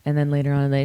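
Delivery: ordinary delivery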